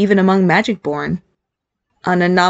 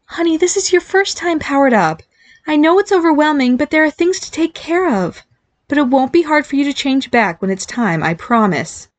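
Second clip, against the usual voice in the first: higher, gently reassuring mom; reassuring mother; 8 semitones higher than usual. A narrator's reading. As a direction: comfort a scared son. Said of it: "genuinely worried about him".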